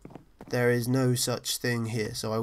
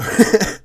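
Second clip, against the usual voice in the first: a loud burst of desperate laughter; 9 semitones higher, 13 dB louder than usual. Real, not acted. Gamer laughs at his own upload desperation, loud desperate laugh. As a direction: laugh like a man at the end of his rope. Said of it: "That's the sound of a desperate man".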